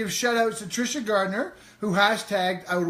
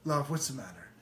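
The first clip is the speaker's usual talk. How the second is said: gentle; 4 semitones lower, 8 dB quieter than usual. Retold or imitated. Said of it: "I'd say".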